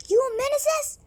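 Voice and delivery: high, angry spluttered outburst; spluttering. A narrator's reading. Soaked zookeeper spluttering at the boys, high and angry. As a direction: splutter it out in outrage. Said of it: "spluttered the zookeeper".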